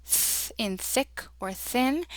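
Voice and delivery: drawn-out voiceless "th" before "thick or thin"; soft TH. Shown as a demonstration. Teacher repeating the special sound, soft voiceless TH. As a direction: hold "th" on breath alone, then give its example words. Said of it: "This is the soft T H sound".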